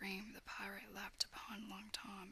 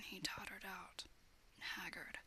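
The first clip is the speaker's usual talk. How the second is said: ordinary delivery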